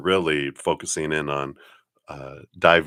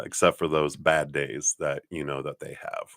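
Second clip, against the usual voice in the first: usual speaking voice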